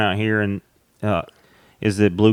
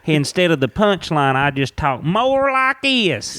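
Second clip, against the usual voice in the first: thickened Southern accent; 5 semitones higher than usual. Shown as a demonstration. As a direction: lay the Southern accent on thick to show the stage voice. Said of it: "this ain't my real accent".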